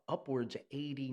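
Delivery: ordinary talk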